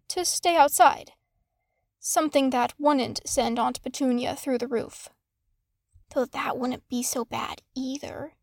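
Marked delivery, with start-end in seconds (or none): 6.1-8.3 whispered aside. Acted. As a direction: whisper it as a sly private thought. Harry's wry inner thought.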